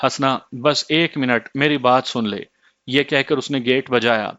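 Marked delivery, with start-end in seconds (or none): none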